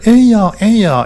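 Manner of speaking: high, loud mock call to his wife; Gyeongsang husband calling. Preacher imitating a Gyeongsang elder calling his wife, high mock call. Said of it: "경상도라니까".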